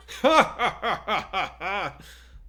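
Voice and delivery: big loud booming laugh; hearty chortle. A narrator's reading. Teacher laughing off a student's offer of punishment, big chortle. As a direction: laugh it off warmly, loud and high. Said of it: "All Might chortled".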